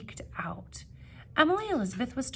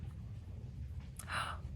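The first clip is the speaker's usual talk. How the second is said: surprised gasp; 12 dB quieter than usual. Acted. Relief at the reveal.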